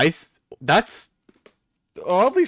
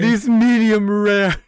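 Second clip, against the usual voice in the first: savoring; 5 semitones higher, 5 dB louder, slower than usual. Acted. Savouring the imagined steaks.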